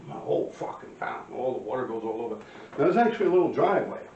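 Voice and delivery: usual speaking voice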